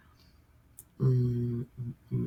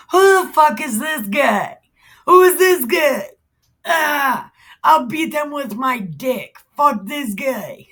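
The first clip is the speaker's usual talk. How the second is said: loud, high-pitched comic bravado; hammed-up indignant shouting as a cocky young fighter; mock outrage; 7 semitones higher, 7 dB louder than usual. Acting David's outrage at Goliath.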